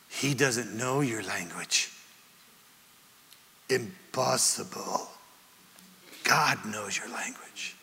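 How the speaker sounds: slow, low and soft, almost whispered at the end; acted hushed reverence, pausing between phrases; hushed awe. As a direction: let the revelation land very quietly. John's awed revelation, acted out.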